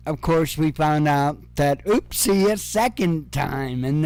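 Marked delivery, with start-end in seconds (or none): none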